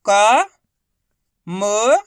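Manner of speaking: "का", then "म", each held alone with a clear pause; syllable by syllable. Shown as a demonstration. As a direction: sound out "का" and "म" separately and loudly. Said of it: "एक एक अक्षर का उच्चारण करके".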